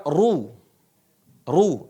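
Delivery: RU with a heavy r and rounded u; thick, full "ru"; ru tebal. Shown as a demonstration. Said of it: "dibaca tebal". (ucapkan "ru" tebal dan bulat)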